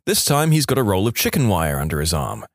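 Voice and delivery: ordinary talk, nothing marked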